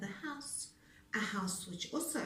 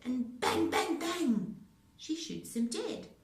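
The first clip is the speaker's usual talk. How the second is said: bang bang; 5 semitones higher, 4 dB louder than usual. A narrator's reading. Gunshots in story.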